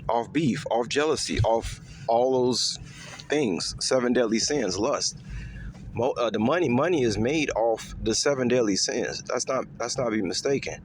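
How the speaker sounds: ordinary talk, nothing marked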